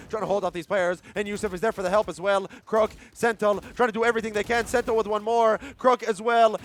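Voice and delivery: loud, high hype call; esports caster. Real, not acted. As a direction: build the play-by-play to a roar. Caster hypes the retake.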